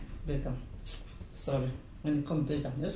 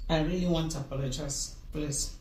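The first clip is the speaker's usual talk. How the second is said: ordinary delivery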